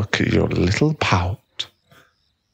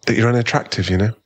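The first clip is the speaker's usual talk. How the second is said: normal talking voice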